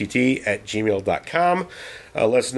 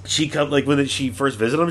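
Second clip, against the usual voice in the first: ordinary talk, nothing marked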